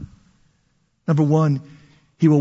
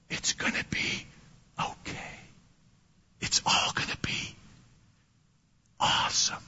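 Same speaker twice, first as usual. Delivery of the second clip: put-on soft comforting whisper to a crying child; tender fatherly whisper; 14 dB quieter than usual. Father whispering comfort to his crying daughter, tender. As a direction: hush her tears like a gentle dad. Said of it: "like a father would to his daughter".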